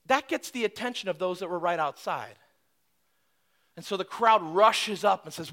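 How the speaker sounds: ordinary delivery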